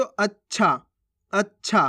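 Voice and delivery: अच्छा with a held stop, "ach-chha"; आधे च के साथ. Shown as a demonstration. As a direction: lean on the half च in अच्छा before छा. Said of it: "इसके अंदर चा लग गया तो". (अच्छा को "अच्-छा", च पर अटककर)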